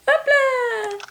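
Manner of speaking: high delighted exclamation; real cheer as the dog performs; excited hop là. Real, not acted. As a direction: cheer the trick on. Dog does a trick.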